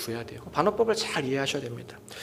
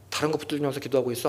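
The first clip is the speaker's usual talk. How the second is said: ordinary delivery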